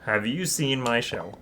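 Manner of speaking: usual speaking voice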